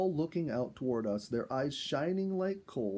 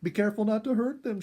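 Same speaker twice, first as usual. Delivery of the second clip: higher, louder, fast warning; 10 semitones higher, 7 dB louder, faster than usual. A narrator's reading. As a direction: urge him quickly to be gentle. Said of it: "she warned".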